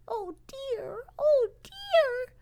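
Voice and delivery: put-on tiny, very high baby-animal whimper; crying voice. Lost baby beaver heard from the stump, crying voice. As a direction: whimper it way up high, like a little creature. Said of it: "the crying voice again".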